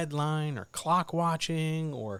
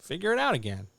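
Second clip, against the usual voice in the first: sarcastic; 9 semitones higher than usual. Acted. Sarcastic permission.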